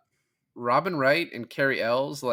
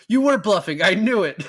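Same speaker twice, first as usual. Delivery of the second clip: higher, louder, gloating delight; smug and excited; 8 semitones higher, 9 dB louder than usual. Retold or imitated. Quoting the smug prince to a co-host, higher gleeful gloat. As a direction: crow like you caught the bluff. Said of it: "he's so happy with himself".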